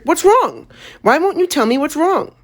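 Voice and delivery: put-on high, urgent pleading child; desperate kid begging for answers; anxious boy. A narrator's reading. Frightened boy demanding answers.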